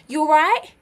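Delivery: put-on a quick Essex-accented greeting. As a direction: say "You're right?" the Essex way, like a casual greeting. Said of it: "they talk with an accent like this".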